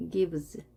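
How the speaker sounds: "gives" drawn out slowly, ending in a buzzed z; voiced ending. Shown as a demonstration. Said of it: "gives로 발음이 됩니다". ("gives" 끝을 즈로 울려 천천히 발음)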